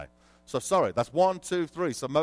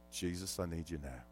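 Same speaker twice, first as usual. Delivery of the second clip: much softer, low plea to Jesus; desperate quiet prayer; 9 semitones lower, 12 dB quieter than usual. Retold or imitated. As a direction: pray it under your breath.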